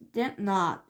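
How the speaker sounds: plain reading voice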